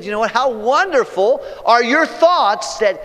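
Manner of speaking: high, loud, slow-building awe; rapturous psalmist. Acted. Preacher voicing the psalmist in soaring praise. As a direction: pour out wonder at being known and loved. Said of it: "Then he said".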